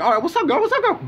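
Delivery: high, keen mock greeting; eager self. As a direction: pitch climbing as he plays it cool and eager. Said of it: "I was like".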